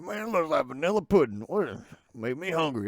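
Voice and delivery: hammed-up dad impression. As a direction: play your dad, exaggerated and loud. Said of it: "do an impression of my dad".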